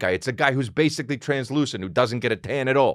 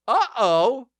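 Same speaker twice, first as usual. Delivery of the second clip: high-pitched sing-song uh-oh; mock alarm; 10 semitones higher than usual. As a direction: sound a small comic alarm, high. Comic alarm at being alone.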